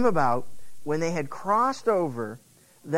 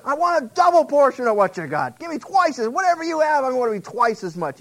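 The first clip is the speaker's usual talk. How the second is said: loud, high, eager demand for more; 7 semitones higher, 6 dB louder than usual. Acted. Young Elisha begging his master, loud and eager. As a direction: blurt it out like a hungry young man. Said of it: "So he goes".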